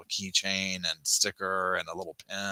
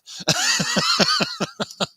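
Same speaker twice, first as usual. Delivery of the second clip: high burst of laughter; 6 dB louder than usual. Real, not acted. Laughs at own tagline.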